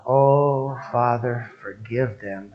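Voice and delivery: drawn-out prayer for repeating; out-loud prayer. Shown as a demonstration. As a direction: lead the crowd phrase by phrase. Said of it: "Say it out loud".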